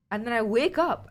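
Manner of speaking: ordinary talk, nothing marked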